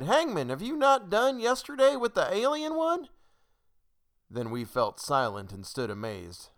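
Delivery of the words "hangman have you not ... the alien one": very high, loud outcry; high-pitched challenge, voice raised; crying out. A narrator's reading. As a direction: cry it out as an alarmed crowd. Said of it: "And we cried".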